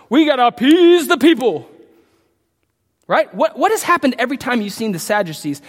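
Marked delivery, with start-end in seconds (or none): 0.1-1.7 mock emperor. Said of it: "Like emperors".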